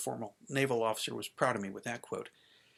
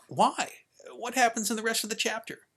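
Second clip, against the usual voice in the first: high, insistent questions; alarmed questioning; 10 semitones higher, 3 dB louder than usual. Acted. Child asking dad about the withheld chapter, alarmed. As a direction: demand an answer, pitched well up.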